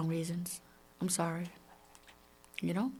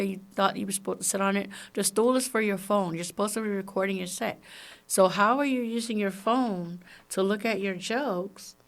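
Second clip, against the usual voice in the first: ordinary delivery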